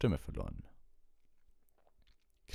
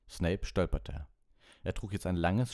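usual speaking voice

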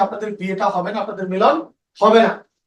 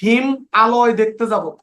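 slow, with হিম and আলয় said apart; halting demo with a gap between হিম and আলয়; split word, slow; slower than usual. Shown as a demonstration. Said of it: "এই শব্দটা উচ্চারণ করা কঠিন".

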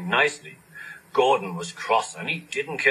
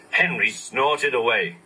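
usual speaking voice

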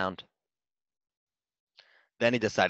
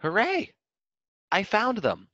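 very high, loud hooray; joyful shout; 13 semitones higher, 5 dB louder, slower than usual. A narrator's reading. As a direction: burst out in delight. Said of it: "Graff let out a shout".

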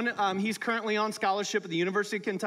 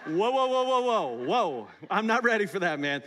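mock startled; 3 dB louder than usual. Acting startled churchgoer's reaction.

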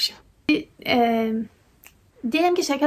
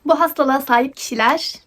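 normal talking voice

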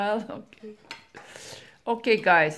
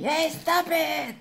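mock yell, higher and drawn out; imitated yelling mom; 8 semitones higher, 4 dB louder, slower than usual. Host playing a mom yelling at fighting kids, mock yell. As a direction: play a mom scolding loudly, pitch jumping up. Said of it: "Sometimes mom goes yelling".

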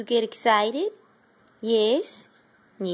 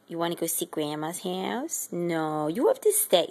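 usual speaking voice

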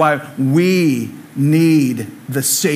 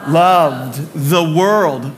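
slow recitation; much slower than usual. Acted. Congregation recites John 3:16.

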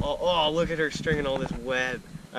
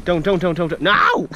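louder, higher urgent warning; alarmed shout; 5 semitones higher, 8 dB louder than usual. Real, not acted. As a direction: yell to stop them. Warning about scorpion sting.

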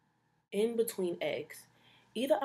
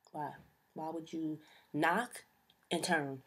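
ordinary talk, nothing marked

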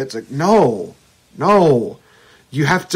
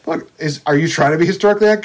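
ordinary delivery

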